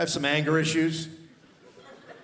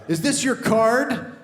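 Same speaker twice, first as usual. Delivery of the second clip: magician Batman; 8 semitones higher, 4 dB louder, slower than usual. Retold or imitated. Batman doing magic tricks.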